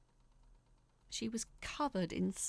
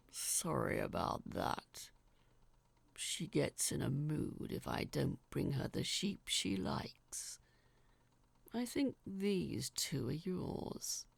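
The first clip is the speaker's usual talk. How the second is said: low, slow apologetic giant; giant's voice; 3 semitones lower than usual. Acted. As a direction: rumble an easy apology like a gentle giant.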